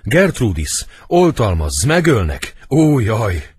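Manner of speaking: high, loud panicked cry; theatrical wail of alarm; acted howl for help. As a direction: cry out for protection in terror. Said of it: "Ordítva rogy mellé".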